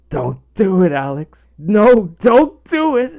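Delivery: loud, high pleading yell. Retold or imitated. Father yelling at toddler about to eat, loud pleading yell. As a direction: yell up at him to stop. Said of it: "I yelled".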